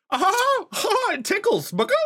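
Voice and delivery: squeaky high chicken voice, giggling; tickled chicken squeaking and laughing. Acted. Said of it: "He's like".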